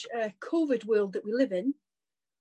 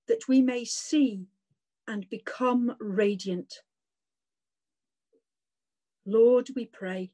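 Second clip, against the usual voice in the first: prayer. Acted. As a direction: pray it aloud, measured and reverent. Leads a spoken prayer.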